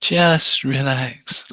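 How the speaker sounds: a light, slow, airy new-age voice; mock meditation guru. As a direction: lightly parody a guided meditation goddess. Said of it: "telling you to".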